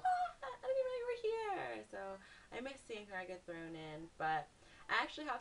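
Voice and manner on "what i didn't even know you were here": high-pitched disbelieving exclamation; re-enacted shock at missing something; astonished reaction. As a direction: squeal it in surprise. Retold surprise at friend's arrival.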